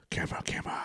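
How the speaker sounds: whispered "Camera, camera" under the breath; soft unvoiced whisper. Real, not acted. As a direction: hush it so only the mic hears.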